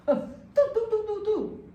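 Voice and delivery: high nonsense syllables in a rant; mock babbling rant. Student ranting at her mother, high mock babble. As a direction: babble like a furious caller. Mimicking angry rant noise.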